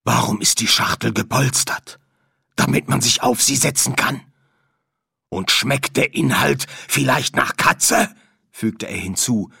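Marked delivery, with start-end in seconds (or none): 0.0-8.1 drohend. Acted. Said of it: "Stimme einen drohenden Klang". (drohend und bedrohlich)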